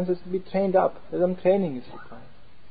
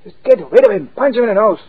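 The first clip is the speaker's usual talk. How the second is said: loud, higher urging to fight; aggressive false ego; 6 semitones higher, 9 dB louder than usual. Retold or imitated. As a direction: egg him on loudly. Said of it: "false ego is there".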